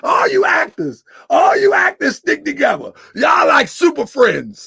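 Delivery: high, loud laughing taunt; laughing shout. Real, not acted. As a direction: yell it through laughter at the actors. Laughing, mocking the chatters.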